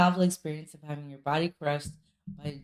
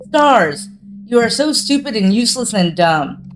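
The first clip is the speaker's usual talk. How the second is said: scream; 2 semitones higher, 6 dB louder than usual. Acted. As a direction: scream it at yourself. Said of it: "and scream".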